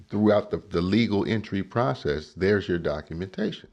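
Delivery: ordinary talk, nothing marked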